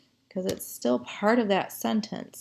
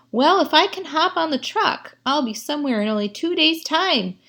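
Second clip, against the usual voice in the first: Sally thinking aloud; 7 semitones higher, 5 dB louder than usual. Acted. Sally's thought read in character.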